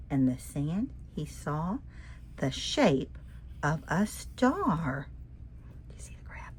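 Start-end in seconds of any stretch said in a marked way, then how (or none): 5.9-6.5 soft secret whisper to the listener; whispered aside. Acted. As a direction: lean in and whisper the question. Reader's whispered aside to children.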